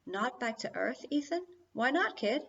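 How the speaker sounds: puzzled, rising repeat of the words; questioning companion. A narrator's reading. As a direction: query it back in the robot sidekick's voice. Philip echoes it as question.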